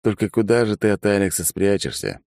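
ordinary delivery, nothing marked

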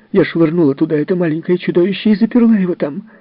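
high, loud, indignant retort; a defiant nurse snapping back; shrill angry nurse. Acted. (высокий возмущённый ответ)

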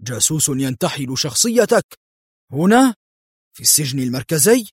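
incredulous echo with rising disbelief; stunned. A narrator's reading. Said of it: "اتسعت عيناه في ذهول". (ترديد بذهول واستنكار)

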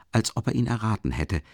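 ordinary delivery, nothing marked

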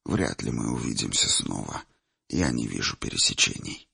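put-on low, quiet old seer; old man voice. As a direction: speak softly as an old man. Old seer Chimir speaks.

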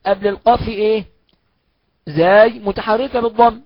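ordinary talk, nothing marked

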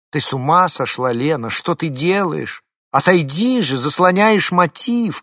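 loud, high shout. Acted. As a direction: yell it out in annoyance. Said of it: "крикнула она". (громкий крик)

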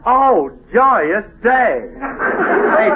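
drawn-out, high, hammed-up cry of joy; mock rapture. [name] thanks Jackson for good news, drawn-out hammed-up joy. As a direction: [name] overplays his relief at Frankie staying.

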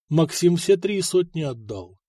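ordinary delivery

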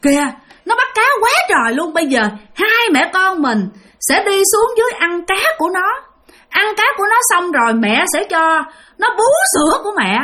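put-on high, eerie mother promising her child food; ghost mother's voice. Ghost mother promising her child the man's fish, high-pitched and eerie. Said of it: "Thì bả mới nói với con bả".

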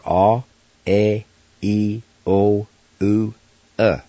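"ah, A, E, O, U, uh", each held as a separate pure vowel; Cherokee vowel sounds. Shown as a demonstration. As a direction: sound out "ah, A, E, O, U, uh" one at a time. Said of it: "back to your vowel sounds".

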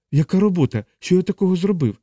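high, bewildered protest; alarmed questioning. Acted. Chaplain baffled by accusation.

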